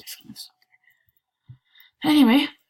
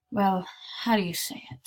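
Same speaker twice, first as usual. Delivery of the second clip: normal talking voice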